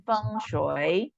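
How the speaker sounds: slow "Feng Shui", level then dipping up; pelafalan Mandarin. Shown as a demonstration. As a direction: model "Feng Shui" slowly, rising at the end. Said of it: "Kita ini bacanya adalah". ("Feng Shui" pelan, datar lalu turun-naik)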